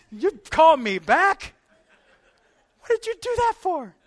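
high, loud mock outrage; indignant Lazarus. Poor Lazarus protesting being called back, in mock indignation. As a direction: squeal a comic complaint at being brought back.

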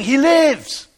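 high, loud shout of news; excited yelling. Retold or imitated. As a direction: yell it across the hills. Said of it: "He's yelling".

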